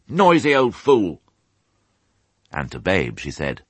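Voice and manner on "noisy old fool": angry. Acted. Dog scolds sheep.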